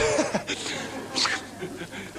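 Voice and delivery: breathy giggle breaking up; nervous giggling fit; burst of laughter. Real, not acted.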